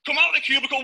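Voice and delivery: ordinary talk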